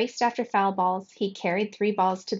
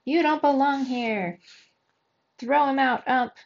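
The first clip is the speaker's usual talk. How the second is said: harsh, mean heckling; 5 semitones higher than usual. A narrator's reading. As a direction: heckle meanly from the stands. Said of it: "Their voices had grown harsh and mean".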